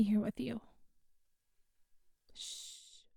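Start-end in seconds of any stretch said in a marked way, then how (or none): 2.3-3.0 soft breathy shush; hushing whisper. Acted. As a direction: shush them gently in the dark. Hushing again.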